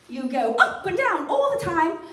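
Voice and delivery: British lilt swinging up and down; British accent demo. Shown as a demonstration. Comic showing how British people talk, bouncing British lilt. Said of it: "how to do a great British accent".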